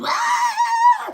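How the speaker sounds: sudden high falsetto shriek, hammed up; mock party scream. Mocking drug-fuelled partiers with a wild high shriek. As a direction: squeal it like a wasted partier.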